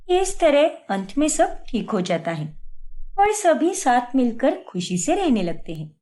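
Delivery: even storyteller narration; story narration. A narrator's reading. Narrator telling a family story, calm storytelling voice. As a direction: tell the tale to the listener.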